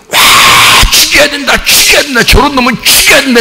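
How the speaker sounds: high, frenzied acted shouting; murderous mob. Crowd plotting to kill Jesus, murderous mob fury. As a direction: roar in, then chant it in high fury. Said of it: "막 예수를 막 죽이려고 막 모의해서요".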